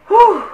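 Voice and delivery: loud sharp blown-out whew, high; angry exhale. Shown as a demonstration. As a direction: blow the anger out in one big breath. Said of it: "pag nagalit kayo".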